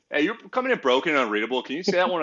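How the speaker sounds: a lightly put-on radio voice; mock radio operator. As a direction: stall the enemy with a polite radio call. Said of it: "And you'd be like".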